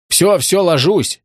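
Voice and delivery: high, hurried-sounding giving in; shouted surrender. A narrator's reading. Suspect gives in to police, high cry of surrender. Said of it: "крикнул он".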